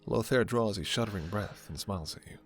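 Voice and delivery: ordinary talk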